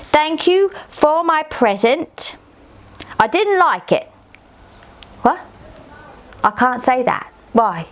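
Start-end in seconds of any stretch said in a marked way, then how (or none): 0.1-4.1 slow, higher letter-reading voice. Pretend. Child reading her letter to viewers, slow and higher. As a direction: sound out each word as you write. Reads letter aloud slowly.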